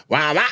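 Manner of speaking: short "wah, wah" prompt at speaking pitch; a brief call for the crowd to answer; comic's cue noise. Retold or imitated. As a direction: toss out two rhythmic wahs. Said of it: "I'm going".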